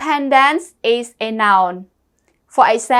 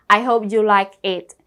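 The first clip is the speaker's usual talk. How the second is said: normal talking voice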